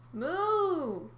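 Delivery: high drawn-out moo; mooing sea cow. Acted. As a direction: moo like a sad calf. Cow serpent calls.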